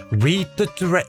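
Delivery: ordinary delivery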